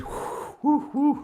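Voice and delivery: long unvoiced whew to let tension out; calming exhale; breathy calming exhale. Real, not acted. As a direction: blow the air out to calm down. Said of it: "Oh, I'm getting worked up".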